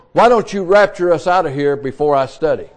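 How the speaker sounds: ordinary delivery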